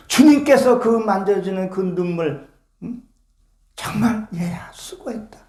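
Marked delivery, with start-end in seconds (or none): 4.3-5.4 tender whisper as God. Acted. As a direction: breathe it softly like a comforting father. (하나님의 목소리로 부드럽게 속삭이듯)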